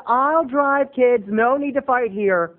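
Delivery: mock peacemaker. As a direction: play the grown-up calming the kids. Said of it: "And I said".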